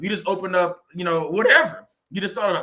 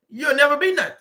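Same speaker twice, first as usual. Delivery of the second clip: louder, high-pitched mocking echo of a put-down; 8 semitones higher, 5 dB louder than usual. Voicing the enemy's lie to believers, high, loud mock taunt.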